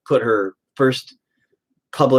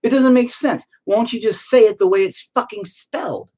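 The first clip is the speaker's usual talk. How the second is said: exasperated rant; 8 semitones higher than usual. Real, not acted. Ranting about spelling.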